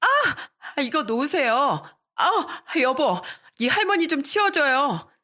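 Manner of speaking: hair grabbed, yelling for help; shrieking in pain. A narrator's reading. Mistress yells to husband while hair pulled.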